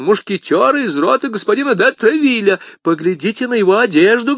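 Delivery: high, agitated tirade; crying out in protest. A narrator's reading. Bonacieux argues the man is a musketeer, agitated. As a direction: keep pleading urgently at a high pitch. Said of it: "вскричал Бонасье".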